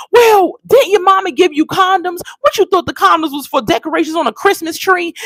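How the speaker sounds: dad's incredulous high telling-off about condoms; angry snap. Retold or imitated. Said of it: "the dad goes and snaps".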